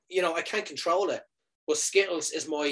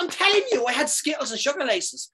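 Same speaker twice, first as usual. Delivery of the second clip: exasperated insisting; 11 semitones higher than usual. Retold or imitated. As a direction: hammer it home, voice shooting up. Insisting to disbelieving nurses.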